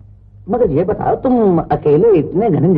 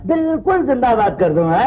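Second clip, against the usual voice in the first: laughing promise; 6 semitones higher than usual. Acted. Cheering up the stranger.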